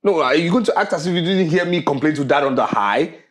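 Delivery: loud, insistent questioning; angry confrontation. Acted. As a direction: confront your mother, pushing through stammers. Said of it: "will not address your mother in that tone".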